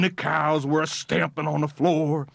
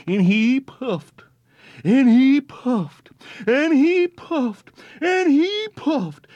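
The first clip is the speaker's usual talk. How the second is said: rising higher and louder each time; building up; 8 semitones higher, 5 dB louder, slower than usual. A narrator's reading. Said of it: "puffed himself up with pride".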